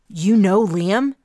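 loud, slow, startled question; eager surprise. Acted. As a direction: suddenly perk up at the name.

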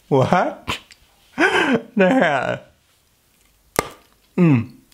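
talking with sweet between teeth; straining to bite off piece; biting into hard candy. Real, not acted. Seen 0: Turrón piece clamped between teeth while speaking, struggling to bite.

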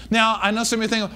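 ordinary talk, nothing marked

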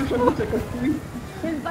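slow, high, stretched in awe; drawn-out amazed. Real, not acted. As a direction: draw it out, amazed. Teammate reacts to the play.

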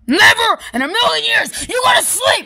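high, loud yelling; high shout. Acted. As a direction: yell it in protest. Yelling refusal.

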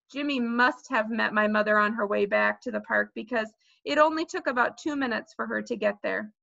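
ordinary delivery

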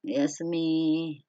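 slow, soft, low sleepy voice. Shown as a demonstration. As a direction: say good night as if drifting off. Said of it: "in a sleepy way".

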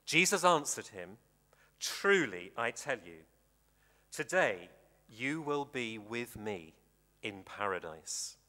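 ordinary delivery

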